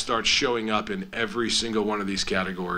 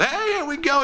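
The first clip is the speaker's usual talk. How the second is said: energetic player's greeting. Retold or imitated. Player lighting up the coaches' office, energetic greeting. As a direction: imitate an upbeat player bursting in, pitched way up. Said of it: "He goes".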